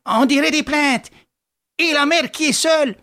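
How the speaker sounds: a son faltering in fear for his mother; stammering son. A narrator's reading. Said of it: "Et un autre balbutia". (fils apeuré qui balbutie)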